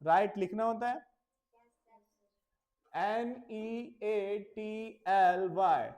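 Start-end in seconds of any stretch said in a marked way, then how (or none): 2.9-5.9 spelling aloud. Shown as a demonstration. Teacher spelling a word for pupils, slow and clear. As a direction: spell "R E A D" slowly letter by letter. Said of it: "neatly".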